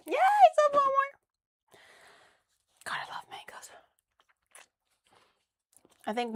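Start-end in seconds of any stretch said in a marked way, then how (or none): 0.1-1.1 much higher, bright squeal on one more; gleeful squeal over the last mango; squealing yay. Real, not acted. Repeated with a cheerful yay. 2.9-3.7 whisper. Real, not acted. Whispering over the mango.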